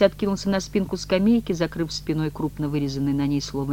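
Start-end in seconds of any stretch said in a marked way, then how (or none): none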